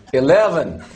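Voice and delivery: a mock American accent that drifts Irish; bad American accent. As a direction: put on a weak American accent for the lift. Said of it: "That sounds Irish, not American".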